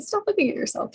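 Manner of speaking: mock self-scold. Scolding herself on camera.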